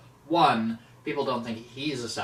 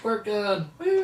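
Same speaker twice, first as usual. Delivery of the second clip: joking cheer; 10 semitones higher, 3 dB louder, slower than usual. Real, not acted. Joking cheer about water weakness.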